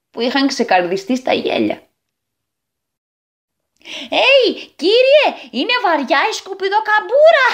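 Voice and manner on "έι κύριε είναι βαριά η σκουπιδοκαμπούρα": mocking boy's shout. A narrator's reading. Boy teasing the man with the rubbish hump, high mocking call. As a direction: jeer like a cheeky little boy. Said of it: "φώναξε το ένα αγόρι".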